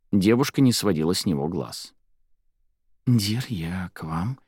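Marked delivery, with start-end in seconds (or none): 3.1-4.4 slow, hushed whisper. A narrator's reading. Girl whispering to the librarian, eye to eye. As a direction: whisper it slowly, drawing out each word. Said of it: "прошептала она".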